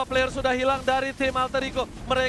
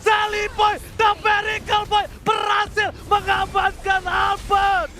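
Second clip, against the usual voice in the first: higher, louder hype call; esports caster; 9 semitones higher, 6 dB louder than usual. Real, not acted. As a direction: shout the clutch save. Clutch play call.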